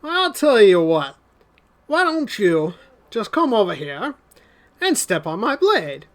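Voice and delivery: leisurely Southern tough guy; Southern accent. Acted. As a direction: invite them over in a put-on Southern accent. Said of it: "second guy with the southern accent".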